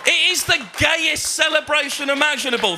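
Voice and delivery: high, loud triumphant shout; excited shout. Real, not acted. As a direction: proclaim it to the room. Comic outburst at punchline.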